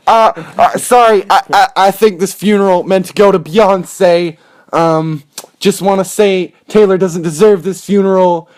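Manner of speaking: high, loud interrupting celebrity. Acted. Rapper crashing a funeral for [name], loud mock interruption. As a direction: burst in and hijack the speech, loudly. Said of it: "in bursts [name]".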